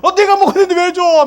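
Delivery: put-on a kid on the verge of tears; child about to cry as bread goes to another; upset child. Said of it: "막 울 거야".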